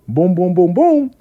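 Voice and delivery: high, punchy booms; rhythmic booms. Shown as a demonstration. Storyteller marking a sudden find, high punchy booms. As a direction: boom it out, leaping up on the last. Storytelling sound effect for quick result.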